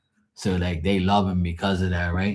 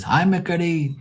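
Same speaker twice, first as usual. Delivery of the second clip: high sweet granny greeting, pitched up; grandma's voice; 10 semitones higher than usual. Retold or imitated. Grandma greeting her grandson in a high sweet coo. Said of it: "And she goes".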